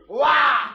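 big loud mock roar, cut short; roar on command. Instructor orders the camp leader to roar, loud animal roar. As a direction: bellow it out like a beast. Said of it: "Zařvete mu".

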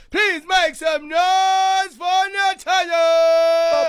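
slow, drawn-out hype call; hype shout. Real, not acted. Radio host introducing the guest.

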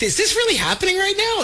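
high-pitched, amazed question; incredulous, voice well up; retold disbelief. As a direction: act out pinching yourself. Retells own disbelief that night.

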